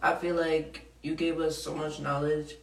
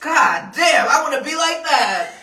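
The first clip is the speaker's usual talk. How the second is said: loud, amazed and laughing; excited shout; 7 semitones higher, 8 dB louder than usual. Real, not acted. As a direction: shout it out in disbelief. Amazed at lawnmower news.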